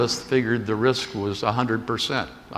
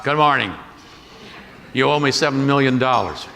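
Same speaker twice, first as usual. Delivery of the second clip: louder, higher, curt demand; stern creditor; 4 semitones higher, 4 dB louder than usual. Retold or imitated. Marriott demanding payment from the airline chief, sternly louder. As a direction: state the debt with a cold edge.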